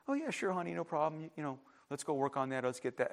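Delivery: soft, easygoing yes from a parent; hushed, obliging parent voice; agreeable parent. Acted. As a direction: agree quietly, no fuss at all. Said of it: "come to your father or your mother".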